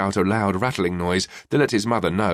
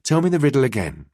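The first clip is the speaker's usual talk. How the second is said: usual speaking voice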